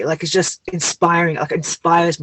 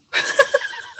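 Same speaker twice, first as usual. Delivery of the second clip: a high squealing laugh, much higher; high giggle; 6 dB quieter than usual. Real, not acted. Host laughing at her own tears, high giggle. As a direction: burst into a high-pitched giggle.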